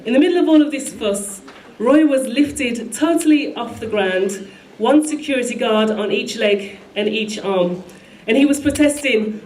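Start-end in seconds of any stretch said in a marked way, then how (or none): none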